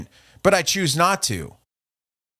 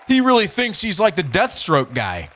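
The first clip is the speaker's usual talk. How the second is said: ordinary delivery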